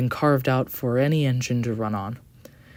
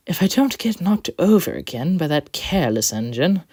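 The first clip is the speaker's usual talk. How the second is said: scoffing; 6 semitones higher, 4 dB louder than usual. Acted. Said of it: "the coach scoffed".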